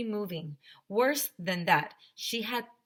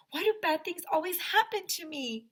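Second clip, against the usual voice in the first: put-on whiny child; 11 semitones higher than usual. Child whining about bad luck.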